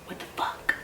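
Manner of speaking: very soft, voiceless retold whisper; stunned whisper. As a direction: whisper it in disbelief, acting out the shock. Stunned confrontation on finding out.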